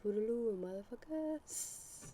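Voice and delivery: high, sing-out farewell with a stretched last word; playful sign-off. Shown as a demonstration. Co-host tries an outro, high drawn-out playful sign-off.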